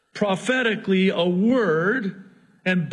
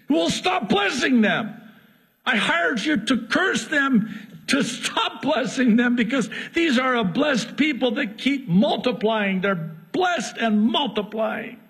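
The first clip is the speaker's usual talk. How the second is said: frustrated Balak; 7 semitones higher than usual. Acted. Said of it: "Balak is infuriated".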